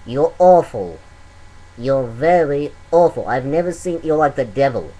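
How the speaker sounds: loud, blunt British judge; hammed-up harsh judge; terrible Simon impression. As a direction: slam the contestant like Simon. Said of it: "That was a terrible Simon impression".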